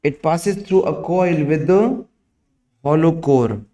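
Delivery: slow, word by word dictation; dictating. Acted. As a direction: dictate slowly for students to write. Dictation continues.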